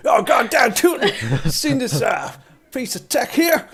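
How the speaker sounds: put-on high, slow, laughing old prospector; hammed-up Wild West drawl; cowboy voice. Wild West saloon role.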